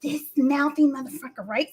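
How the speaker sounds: ordinary delivery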